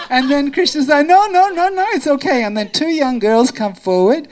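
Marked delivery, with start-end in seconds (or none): 1.1-2.4 high, loud flurry of protest; acted panicky protest; flustered refusal. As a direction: blurt out a hurried, flustered no. Krishna hurriedly refuses being undressed.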